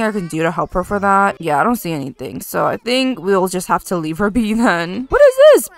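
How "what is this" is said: high, loud startled question; startled surprise. Real, not acted. Gamer spotting a new pop-up, startled and high. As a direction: squeal it in sudden surprise.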